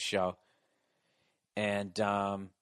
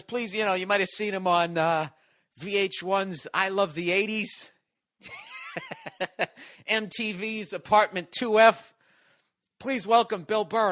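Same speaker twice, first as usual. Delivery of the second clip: higher emcee intro with laughs; laughing emcee intro; 6 semitones higher than usual. Retold or imitated. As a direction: introduce him, cracking up. Host introducing the teller.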